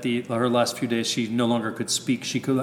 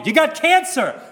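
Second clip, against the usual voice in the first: much higher, exasperated mock shout; exasperated retort; 5 dB louder than usual. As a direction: snap back at her in disbelief. Arguing with nan about cancer.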